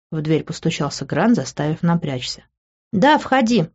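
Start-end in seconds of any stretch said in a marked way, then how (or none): 2.9-3.7 higher-pitched call to come in. A narrator's reading. Woman answering a knock at her door, calling out. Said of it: "крикнув".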